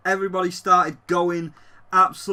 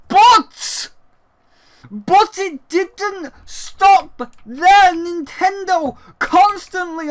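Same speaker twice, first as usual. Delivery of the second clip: booming, drawn-out and much higher; dramatic announcer build-up; 9 semitones higher, 5 dB louder, much slower than usual. Acted. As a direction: stretch it out like a trailer voice.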